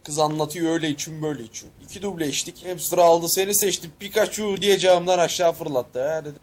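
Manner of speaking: normal talking voice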